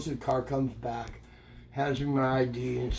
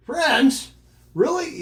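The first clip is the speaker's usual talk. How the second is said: much higher, louder disbelief; eager boy perking up; surprised kid; 10 semitones higher, 6 dB louder, slower than usual. Retold or imitated. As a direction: jump at the news like a thrilled boy. Grandson hears cop has friends.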